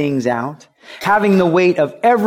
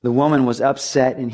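usual speaking voice

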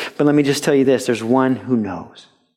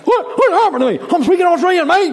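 mock shocked; faster than usual. Believer shocked to wake up speaking Australian, high and laughing. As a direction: play it as a laughing, startled fake Australian.

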